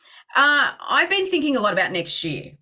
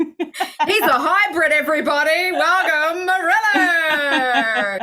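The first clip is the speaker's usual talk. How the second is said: announcer; 4 semitones higher, slower than usual. Acted. Announcing the guest again.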